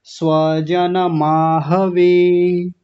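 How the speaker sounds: "स्वजन माहवे" slow and drawn out; full vowels. Shown as a demonstration. Teacher demonstrating for students, slow chanted "स्वजन माहवे". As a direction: sustain every vowel in "स्वजन माहवे", swa-ja-na maa-ha-ve. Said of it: "ऐसा".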